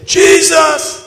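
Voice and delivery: high, loud cry of the name; shouted name. Retold or imitated. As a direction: call out Jesus in desperation. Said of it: "if you say".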